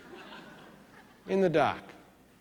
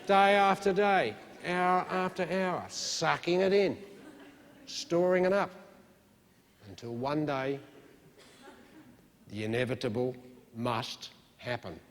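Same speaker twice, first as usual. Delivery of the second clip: mock spooky warning. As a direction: tease out the looming catastrophe.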